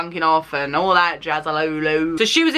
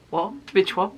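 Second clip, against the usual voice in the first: shocked what; 3 dB quieter than usual. Retold or imitated. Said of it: "My reactions were".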